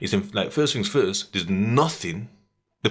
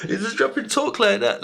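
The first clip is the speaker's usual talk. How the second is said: high, amused repeat after cracking up; laughing while talking; 11 semitones higher than usual. Real, not acted. Amazed at the man's patter, laughing while talking. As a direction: say it just out of a laugh.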